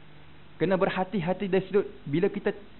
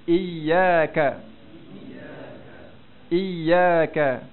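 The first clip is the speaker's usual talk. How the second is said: "Iyyaka" loud, leaning into the long "yy"; stressed doubled ya; 4 dB louder, much slower than usual. Shown as a demonstration. Teacher leads students in repetition, stressed "yy" in "Iyyaka". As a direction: press the "yy" in "Iyyaka" for repeating. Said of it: "Okey, cuba sebut".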